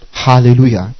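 usual speaking voice

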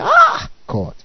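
squeaky high mock exclamation; lightly hammed-up gasp of piety; mock theologian. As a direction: mimic a theologian's awed cry.

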